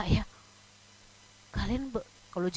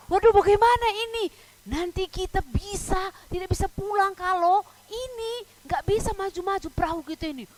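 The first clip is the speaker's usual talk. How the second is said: higher-pitched, acted dismay; hammed-up worried exclamation; worried boatmen; 7 semitones higher, faster than usual. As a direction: fret aloud like someone stuck at sea. Voices the stranded boatmen's dismay.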